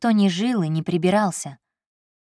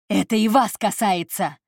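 shouting; 3 semitones higher than usual. A narrator's reading. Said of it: "закричал Кристиан".

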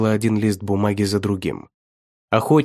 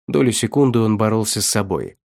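usual speaking voice

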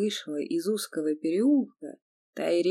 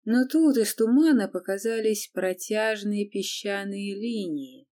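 plain reading voice

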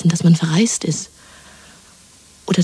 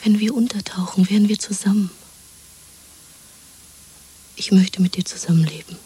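tender whisper. Acted. Woman begging her lover to disappear with her: tender whisper. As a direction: whisper it close to his ear.

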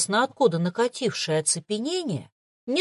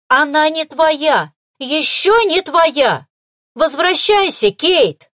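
slow, loud determined order; resolute command; 7 semitones higher, 9 dB louder, slower than usual. A narrator's reading. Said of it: "решительно скомандовала она".

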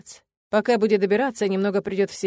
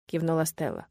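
usual speaking voice

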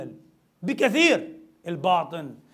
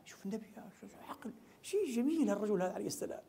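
much softer, admiring murmur; awed hushed aside; 2 semitones higher, 12 dB quieter than usual. Real, not acted. Preacher marvelling at the Prophet's wisdom, hushed. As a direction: drop the voice in wonder.

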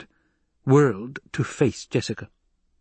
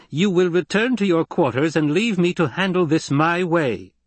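higher, firm dismissal; stinging command; 7 semitones higher than usual. A narrator's reading. Lady dismissing the doctor, stingingly commanding. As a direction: cut in and overrule firmly. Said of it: "to take the sting out of her command".

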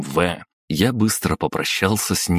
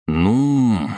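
long, higher musing sound; thoughtful drawl; 6 semitones higher, 3 dB louder than usual. A narrator's reading. Master pondering how much money is left, thoughtfully drawn out. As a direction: stretch it out as if weighing it up. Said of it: "протянул я задумчиво".

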